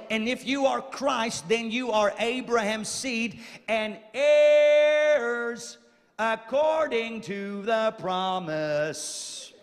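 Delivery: slow, sung-out preacher's cadence over the organ; preacher half-singing the promise; intoned preaching. Acted. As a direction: stretch out heirs like a chant.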